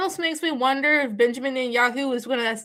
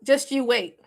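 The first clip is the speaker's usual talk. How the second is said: normal talking voice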